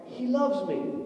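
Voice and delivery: a touch of exuberance; happy believer. Retold or imitated. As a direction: say it like everything is great.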